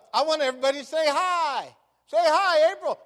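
high, loud call to everyone; rallying a class. Retold or imitated. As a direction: re-enact getting a whole room to greet someone.

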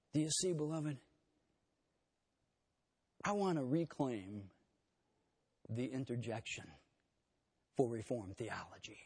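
ordinary talk, nothing marked

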